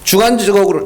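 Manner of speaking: ordinary talk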